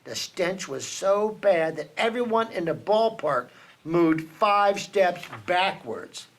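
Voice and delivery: ordinary delivery, nothing marked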